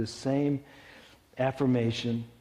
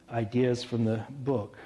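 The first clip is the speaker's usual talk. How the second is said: ordinary talk, nothing marked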